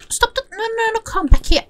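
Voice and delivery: high-pitched, flustered no-no-no; high urgent calling. Real, not acted. Player calling a runaway horse back, high and urgent. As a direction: call after a runaway in a high voice.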